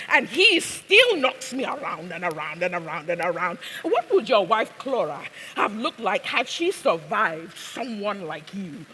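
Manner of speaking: put-on loud, high accented tirade, falling away at the end; impassioned accented stage speech; Abasiama, embittered. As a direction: press the bitter point in an accented voice. Plays Abasiama in the scene.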